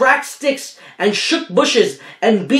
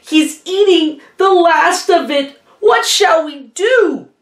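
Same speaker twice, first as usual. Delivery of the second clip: much higher, anxious voices; fretting creatures; 10 semitones higher than usual. Acted. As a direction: panic lightly in a high creature voice.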